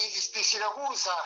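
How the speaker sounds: ordinary talk, nothing marked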